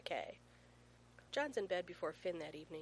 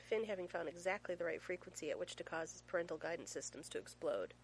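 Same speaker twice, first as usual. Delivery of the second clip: plain reading voice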